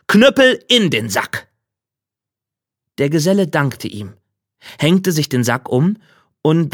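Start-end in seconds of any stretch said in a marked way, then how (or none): 0.1-1.5 higher-pitched called-out command; Befehl vorrufend. Acted. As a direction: call out the magic command crisply. Meister macht den Befehl vor. (laut vorgerufener Befehl)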